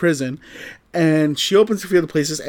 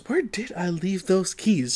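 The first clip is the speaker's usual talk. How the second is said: lightly imitating her puzzled, quieter wondering; her puzzled intonation; 3 semitones higher, 6 dB quieter than usual. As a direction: echo her absent-minded question softly. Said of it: "Said in that intonation".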